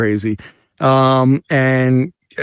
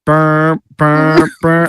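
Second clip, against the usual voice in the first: mock band noise; 2 semitones higher, 4 dB louder, much slower than usual. Mimics band playing.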